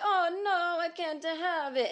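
high, drawn-out Italian lilt, hammed up; overdramatic Italian accent. Comic retelling an Italian man's refusal, overdramatic Italian accent. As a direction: imitate an overdramatic Italian man wailing in protest. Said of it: "he was very overdramatic".

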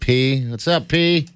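playful high-pitched hello; mock greeting. Trying out a baby nickname, mock high-pitched greeting.

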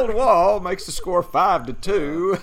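hammed-up radio home run call; announcer call. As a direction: boom it out like a ballpark announcer. Continues the joke home run call.

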